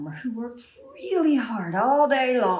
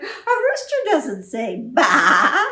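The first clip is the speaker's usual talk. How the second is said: surprised farmer; 4 semitones higher, 7 dB louder, slower than usual. Acted. Surprised farmer corrects rooster.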